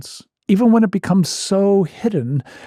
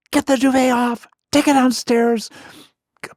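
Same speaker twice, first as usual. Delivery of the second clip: bossy orders; 9 semitones higher than usual. Retold or imitated. Wife ordering cleanup, quoted.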